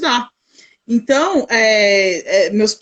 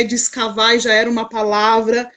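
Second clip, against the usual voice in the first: ordinary talk, nothing marked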